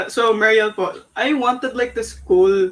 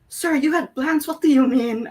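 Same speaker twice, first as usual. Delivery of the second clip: higher, puzzled quoted question; confused; 6 semitones higher than usual. Retold or imitated. Asking the mentor about his plan, higher puzzled tone. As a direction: ask it like you can't follow him. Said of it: "And I was like".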